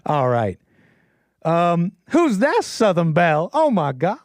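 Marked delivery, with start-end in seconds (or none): 1.4-3.5 slow put-on Southern belle voice. Streamer's Savannah character greets chat, Southern belle voice. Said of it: "My character's from Savannah".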